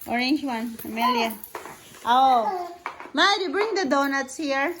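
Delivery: ordinary talk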